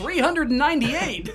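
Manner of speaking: excited shout. Real, not acted.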